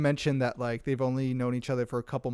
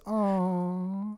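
higher, soft sympathetic aww; mock crowd aww; 4 semitones higher, 4 dB quieter than usual. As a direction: coo like a touched audience. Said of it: "Everybody goes".

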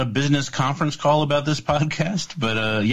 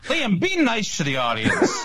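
laughing; 10 semitones higher, slower than usual. Real, not acted. Laughing while scolding co-host.

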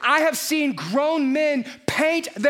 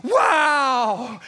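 awed wow. Retold or imitated. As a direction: gasp it out in wonder. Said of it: "I'm like".

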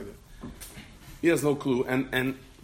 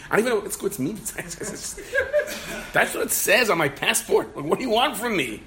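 retold bewildered protest; 9 semitones higher, 4 dB louder than usual. Bewildered man protests being screamed at.

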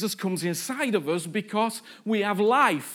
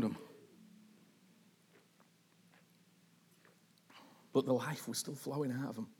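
quiet reflective preaching; 7 semitones lower, 6 dB quieter than usual. Acted.

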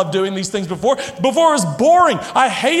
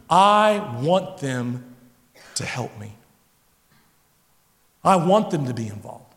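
ordinary talk

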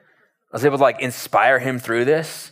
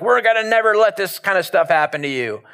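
higher-pitched show of bravado; 6 semitones higher than usual. Acted. Peter vowing to protect Jesus, with bravado. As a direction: play the protective tough friend. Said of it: "machismo, bravado".

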